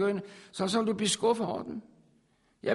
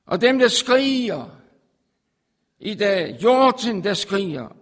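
fervent preaching; 2 semitones higher, 8 dB louder than usual. Acted. Preaching at full force.

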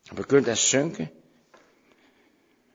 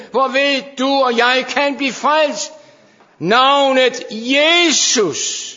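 loud, high, impassioned preaching; fervent sermon climax; full-force preaching; 11 semitones higher, 8 dB louder than usual. Acted. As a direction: preach it out to the congregation.

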